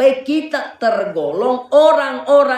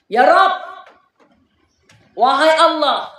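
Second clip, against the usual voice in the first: Iblis pleading; 3 semitones higher than usual. Retold or imitated. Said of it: "Dia berkata kepada Allah".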